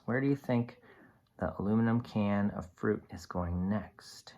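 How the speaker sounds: soft whisper to the class; hushed guessing game; whispered question. Real, not acted. Teacher asks class to guess.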